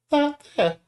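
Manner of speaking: high-pitched Nami, hushed and curious; high girl voice. Acted. Nami asks her friend about a rustling noise, high girl voice.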